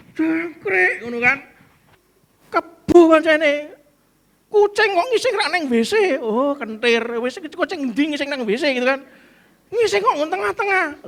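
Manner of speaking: high, loud cursing at the cat; hammed-up rage over cat mess; angry cursing. Someone who stepped in cat mess yelling high and loud. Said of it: "Dulu Anda akan marah-marah".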